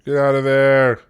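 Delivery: yelled urgent command; shouting orders over battle. Real, not acted. Commanding troops in a siege.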